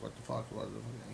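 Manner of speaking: under-the-breath puzzled grumble; muttered disbelief. Retold or imitated.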